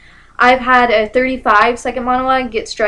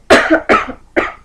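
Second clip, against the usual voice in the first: real loud throat-clearing cough; sudden sharp coughing burst; choking cough; 7 semitones higher, 6 dB louder than usual. Real, not acted. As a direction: cough hard from choking on spit. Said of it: "choked on my spit a little bit".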